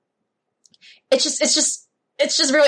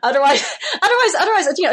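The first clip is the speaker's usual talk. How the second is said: laughing; 9 semitones higher, 3 dB louder, faster than usual. Real, not acted. Amused self-correction.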